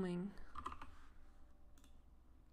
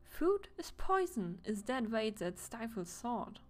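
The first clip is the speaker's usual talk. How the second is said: eccentric poet passenger; 3 semitones higher than usual. Acted. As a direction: preach like an artist.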